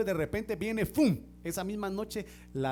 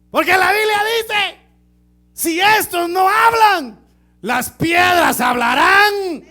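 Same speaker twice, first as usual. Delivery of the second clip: slow, shouted high pulpit cry; fiery sermon climax; preaching at full force; 12 semitones higher, 17 dB louder, slower than usual. Acted. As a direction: thunder it out from the pulpit.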